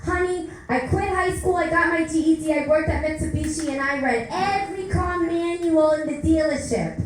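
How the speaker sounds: put-on a touch of a fast Queens accent; Queens mother voice. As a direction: rattle it off like a Queens mom. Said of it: "a fast talker from Queens".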